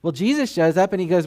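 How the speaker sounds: ordinary talk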